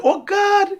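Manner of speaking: put-on high, delighted "Oh God" from the girl; mock amazed girl. Girl at the gate admiring his suit, high and delighted. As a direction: gush over him like the admiring girl. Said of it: "the girl say".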